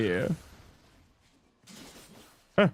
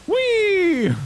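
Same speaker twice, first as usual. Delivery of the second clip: tiny cartoon voice squealing high; high squeaky whee; 4 dB louder than usual. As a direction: squeal it like a giddy cartoon critter. Playing silly character voice.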